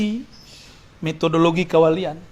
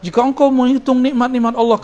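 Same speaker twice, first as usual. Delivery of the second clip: higher-pitched, lightly mocked preacher quote; imitated sermon; 7 semitones higher, 4 dB louder than usual. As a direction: rattle off a stock sermon line, pitched up. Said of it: "dicekokin dalam khutbah-khutbah".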